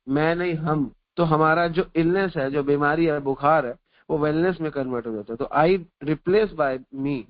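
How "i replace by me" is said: very slow, word by word; slow maxim. Acted. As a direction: spell out the lesson slowly for the listeners. Repeating the maxim slowly.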